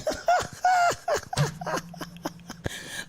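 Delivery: high, airy, silent laugh; breathless laugh. Real, not acted. Host laughing at her own thirst day pun, airy wheeze.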